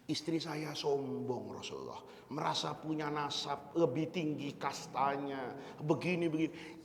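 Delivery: a hurt husband pouring out his grievance quietly; complaining husband. Acted. Zaid complaining to the Prophet.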